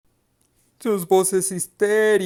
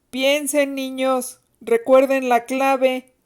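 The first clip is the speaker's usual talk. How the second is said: mild mock yell, higher-pitched; higher, drawn-out taunting call; 4 semitones higher, slower than usual. A narrator's reading. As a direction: call it out to the children without real volume. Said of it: "les dijo ella a gritos".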